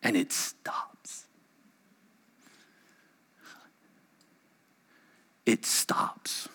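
slow, near-silent whisper; stunned, breath-only hush; hushed awe. Real, not acted. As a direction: drop to a whisper as the storm ceases. Sudden hush after shouted storm.

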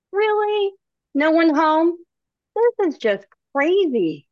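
high-pitched, incredulous questioning; exasperated disbelief. Acted. Gustav at an unanswered door, in exasperated disbelief. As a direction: start high in disbelief, then draw it out.

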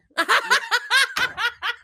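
big high burst of laughter; loud laugh. Real, not acted. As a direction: crack up loudly. Laughing at a joke.

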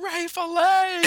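high, loud drawn-out yell; retold scream. Host recalls yelling at a show while driving, screaming. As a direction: scream it out, stretching the name. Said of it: "I was screaming at the thing".

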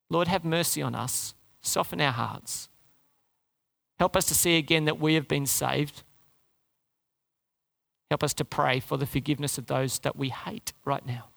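soft, low earnest prayer. Real, not acted. Preacher praying for the congregation, soft and earnest. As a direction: pray it gently over the church.

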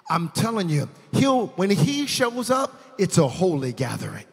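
loud, high preaching voice; rising shout of praise; preaching at full force. Acted.

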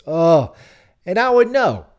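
high, loud ironic aside; a high, loud self-deprecating quip; self-mocking. Real, not acted.

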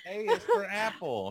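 put-on high, loud children's TV voice; sing-song like a preschool host; kids' show host. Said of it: "It's like Sesame Street".

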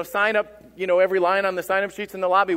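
ordinary talk

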